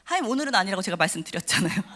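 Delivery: usual speaking voice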